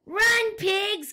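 very high, loud shout; cried out. A narrator's reading. Explorer guinea pig shouts at the pigs to run. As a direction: scream the order to flee. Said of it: "cried Fluffy the Explorer".

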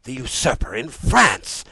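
high, loud outrage; alarmed royal exclamation; cried out. A narrator's reading. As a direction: cry it in appalled disbelief. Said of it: "he cried".

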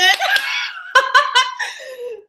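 high giggling burst; high laugh. Real, not acted. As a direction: squeal with laughter. Laughing at joke.

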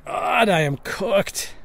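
high, loud and slow despair; loud drawn-out groan of doom; dramatic wail. Acted. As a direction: drag it out like the world is ending. Panicking over the mistake.

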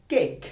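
কেক with one short "e" vowel; common mispronunciation. Retold or imitated. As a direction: imitate the local "kek" for কেক. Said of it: "আমরা অনেকেই বলি".

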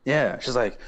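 ordinary delivery

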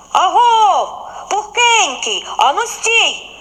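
high, loud call of a hare; hare shouting. A narrator's reading. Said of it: "крикнув вухань". (заєць гукає високим голосом)

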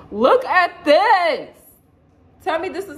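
loud, high, slow delight; excited exclaim. Real, not acted. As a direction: call it out, thrilled. Showing off new top.

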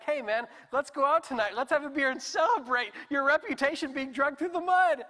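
cheerful shout of invitation; excited invitation. Acted. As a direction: sell the celebration like a hyped friend. Voicing Jesus' upside-down invitation.

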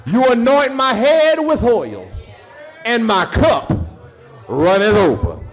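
high, loud whooping preacher cadence; sing-shout each line up to the congregation; preaching at full force. Real, not acted.

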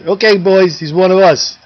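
yell to the crowd. Retold or imitated. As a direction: holler over the bar to the boys. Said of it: "yells to the rest of the crowd".

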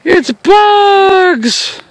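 surprised yelp. Real, not acted. Gnats swarming the camera.

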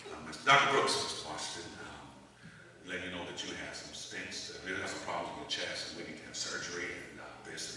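low, soft doctor voice with no inflection; deadpan, unhurried medical calm; calm, cool doctor. Retold or imitated. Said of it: "he was just calm and cool".

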